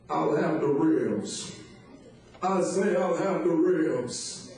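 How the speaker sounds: preacher impression. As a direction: deliver a food order like a grand speech. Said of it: "it sounded like this".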